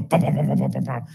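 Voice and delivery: rhythmic mock wrench noise, fading at the end; imitated impact wrench. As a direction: tap out the wrench sound like a machine. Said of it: "the sound of an impact wrench going".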